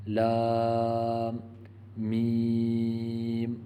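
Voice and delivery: لام and میم held very long; drawn-out madd. Shown as a demonstration. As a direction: stretch لام and میم slowly, lengthening each vowel. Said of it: "اس میں دو جگہ پر مد ہے".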